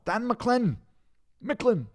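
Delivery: high, loud put-on Scots name; mock Scottish accent. Streamer getting back to Dan, retrying mock Scottish accent. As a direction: belt the name in hammed-up Scottish. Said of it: "I don't remember what the first one was".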